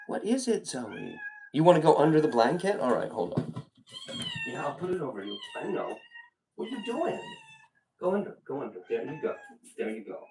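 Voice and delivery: hushed, coaxing murmur; soft aside. Real, not acted. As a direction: talk softly to a pet being tucked in. Talks aside to Zoe under blanket.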